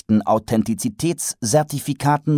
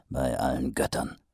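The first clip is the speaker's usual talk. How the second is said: thought; 8 semitones lower, 8 dB quieter than usual. Acted. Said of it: "fuhr es ihm durch den Sinn".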